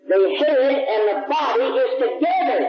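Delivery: proclaiming shout. Real, not acted. Preacher proclaiming the message to the church, shouting. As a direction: ring out the message.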